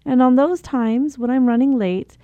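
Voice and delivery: normal talking voice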